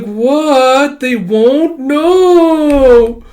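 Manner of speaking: high, loud cry of disbelief; hammed-up horrified outburst; shocked dismay. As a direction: wail in mock horror, stretching the no. Acted dismay at the news.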